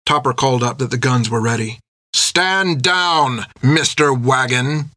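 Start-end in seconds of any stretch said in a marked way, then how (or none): 2.1-4.9 stern, slow order; captain orders Jack to stand down; commanding captain. Acted. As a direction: put him in his place.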